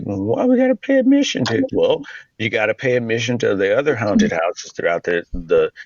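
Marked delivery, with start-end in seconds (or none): 0.0-1.6 whining customer. Retold or imitated. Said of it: "and then, like".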